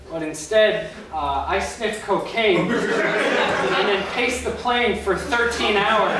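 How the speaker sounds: ordinary talk, nothing marked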